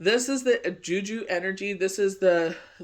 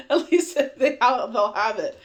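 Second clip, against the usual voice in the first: laughing through words; 5 semitones higher, 6 dB louder than usual. Real, not acted. Telling viewers about parcels while still laughing, higher and louder.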